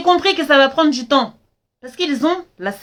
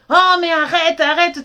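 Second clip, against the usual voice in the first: imitating dismissive father; 6 semitones higher than usual. Father voiced, brushing her off.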